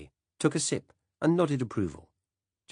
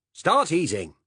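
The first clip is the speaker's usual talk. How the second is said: loud, higher barked order; commanding; 10 semitones higher, 8 dB louder, slower than usual. Acted. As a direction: order them to eat at once. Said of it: "Silas commanded".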